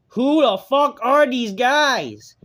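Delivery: high, loud, drawn-out outburst; incredulous, stretched-out shout; exasperated shout. Real, not acted. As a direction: yell it slowly in exasperation. Exasperated rant about unknown wrestlers.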